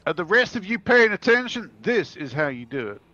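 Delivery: showing off to the group; cocky brag; boastful. Acted. Boasting in character.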